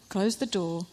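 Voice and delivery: usual speaking voice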